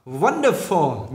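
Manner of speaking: "Wonderful" slow, stress on the first syllable; nhấn âm đầu. Shown as a demonstration. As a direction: hit WON in "Wonderful", then fall. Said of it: "nhấn âm đầu". ("Wonderful" đọc chậm, nhấn âm đầu)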